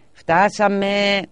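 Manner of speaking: slow, high call; waking call. A narrator's reading. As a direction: rouse a sleeping child gently. Voice waking the sleeping girl. (αργό, ψηλό κάλεσμα σε κοριτσάκι που κοιμάται)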